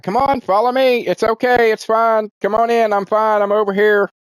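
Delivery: calling through. Retold or imitated. Clark urging friends through the wall, high coaxing call. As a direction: call them to follow, high and bright-toned.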